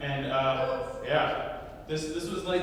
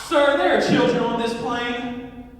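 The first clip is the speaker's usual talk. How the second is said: slow, loud, higher-pitched reprimand; scolding mom; 5 semitones higher, 6 dB louder than usual. Retold or imitated. Mom stands up to confront the loud caller, scolding. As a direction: stretch out the outraged objection. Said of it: "And she goes".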